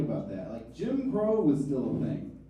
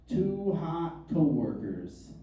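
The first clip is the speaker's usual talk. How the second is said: slow sultry title read; 3 semitones lower, 6 dB quieter, slower than usual. Retold or imitated. Reading porn title slowly.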